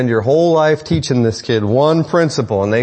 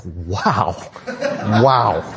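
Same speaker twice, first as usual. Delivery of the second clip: laughing wow; 4 semitones lower than usual. Retold or imitated. Parent amazed by nonstop talker kid.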